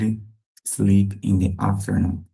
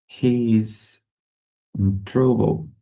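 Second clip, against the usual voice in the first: drawn out, with a pause before "in trouble"; slow, word-group by word-group for learners; slow model sentence. Shown as a demonstration. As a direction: hold "He is", pause, then finish. Teacher models slowly, then at usual pace.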